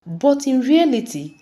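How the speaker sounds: ordinary delivery, nothing marked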